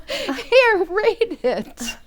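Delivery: amused, bubbling laughter; laughing. Acted. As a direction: giggle as you hand it over. Mother amused by telegram.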